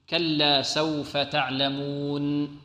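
slow, soft "كلا سوف تعلمون", lingering on the final ن; measured recitation. Shown as a demonstration. Teacher reciting the verse for students, slow held nun. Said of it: "هنا عندما نقف على النون".

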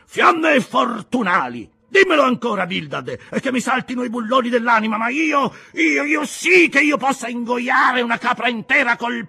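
high, loud furious shouting; thundering. A narrator's reading. Peleg thunders at Bildad and drives him out. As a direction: bellow out every insult. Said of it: "Così tuonando".

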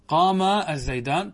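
قام with a loud, long final aa before الزيدان; rejected dual alef. Shown as a demonstration. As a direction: slowly stretch qaamaa, then الزيدان. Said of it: "أن تقول هكذا". (مدّ آخر «قام» بصوت عالٍ قبل «الزيدان»)

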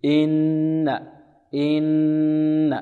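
إن with the nun held long and nasal; full ghunna. Shown as a demonstration. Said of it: "غن ماذا أكمل". (إن بغنة كاملة ممدودة)

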